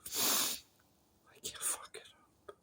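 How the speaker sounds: slow, breathless whisper. Real, not acted. Steeling himself after the mock prayer, a slow whisper. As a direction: whisper it slowly to yourself.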